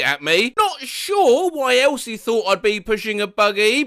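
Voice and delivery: normal talking voice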